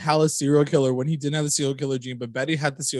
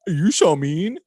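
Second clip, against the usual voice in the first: mock hurt; 5 semitones higher, 3 dB louder, slower than usual. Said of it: "I was like".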